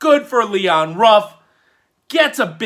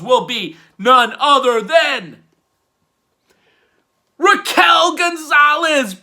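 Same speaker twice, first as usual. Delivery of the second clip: much higher, louder name bellow; ring announcer call; 8 semitones higher, 6 dB louder than usual. Pretend. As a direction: belt the name out like an arena announcer.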